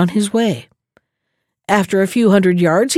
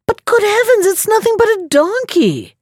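mock astonishment; 11 semitones higher than usual. Rogue feigns shock.

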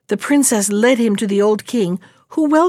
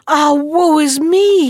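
high, slow lament of grief; cried bitterly; 6 semitones higher, 4 dB louder, slower than usual. Acted. Brother at the bleeding fig tree, cries out in grief. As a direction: wail it out for a dying brother. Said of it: "he cried bitterly".

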